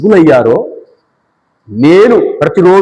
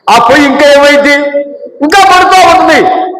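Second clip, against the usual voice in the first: fervent preaching; 10 semitones higher than usual. Acted. Pastor calling out to the church about the fire. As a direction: stretch it out with fervour.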